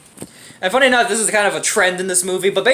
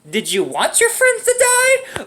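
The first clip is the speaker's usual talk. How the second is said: mock disbelief; 12 semitones higher, slower than usual. Mocking the character's choice.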